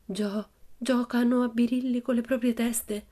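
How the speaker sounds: halting, timid echo of the ghost story; frightened murmur. Acted. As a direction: murmur it back, stumbling with fear. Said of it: "mormorò Annika".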